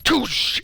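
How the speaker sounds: high burst of a chute opening; short mouth-made whoosh; imitated whoosh. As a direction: mimic a parachute popping.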